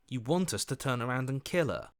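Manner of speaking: ordinary talk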